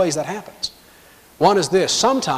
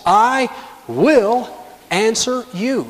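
"I will" raised, then a pause before "answer you"; emphatic; 5 semitones higher, much slower than usual. Acted. Preacher showing how firmly God promised, emphatic. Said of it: "notice how emphatically this is stated".

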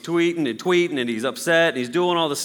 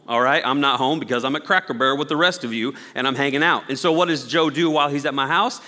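ordinary delivery

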